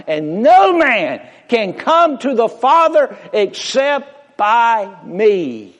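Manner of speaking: high, slow and loud, proclaiming each word; ringing preached Jesus quote. Acted. Preacher voicing Jesus to the congregation, high and ringing. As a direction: lift the words of Jesus like a herald.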